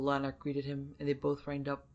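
ordinary delivery, nothing marked

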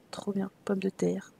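whispered aside; hushed little whisper. Real, not acted. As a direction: breathe it under your voice. Whispered aside while shopping in game.

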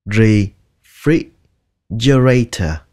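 refrigerator in slow separate chunks, ri stopped short; syllable by syllable. Shown as a demonstration. Teacher models the word for repeating, slowly in pieces. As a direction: break refrigerator apart slowly. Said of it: "xong rồi các bạn chặn hơi nó lại".

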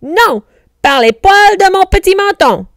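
high, loud piglet protest; squealed, forceful objection. A narrator's reading. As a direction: cry out a defiant refusal. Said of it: "protestèrent les cochons".

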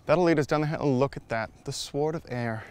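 ordinary talk, nothing marked

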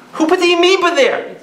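high-pitched mock-incredulous question; retold challenge in a high voice. Retold argument with young atheist.

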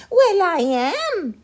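very high, slow, loud admission of fear; a mama who is truly scared; frightened mother. A narrator's reading. Mother admits her fear of the chupacabra, high frightened voice. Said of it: "their mother said".

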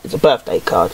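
low, flat-toned announcement of the obvious; mock deep voice. Deadpan low repeat.

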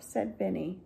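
plain reading voice

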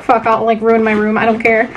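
ordinary talk, nothing marked

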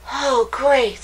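bright eager little rabbit; thrilled at the news; excited. A narrator's reading. Hopper hearing spring is coming, excited. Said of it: "said Hopper excitedly".

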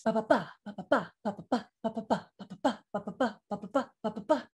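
meter on bare syllables, da-da-DUM; galloping "ba"s. Shown as a demonstration. Coach repeats the meter without the words, like a gallop. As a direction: tap out a horse's gallop in short "ba"s. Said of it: "one more time without the words".

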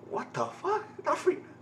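high, hushed, stunned; stunned disbelief. Real, not acted. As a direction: whisper out your shock at the screen. Stunned by a scene.

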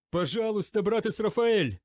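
high, loud desperate plea; pleading shout. A narrator's reading. Zachariel begs Israfael for help, shouting. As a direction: cry out to a trusted brother. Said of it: "закричал Захариэль".

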